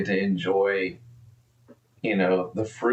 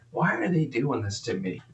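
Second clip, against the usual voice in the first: ordinary talk, nothing marked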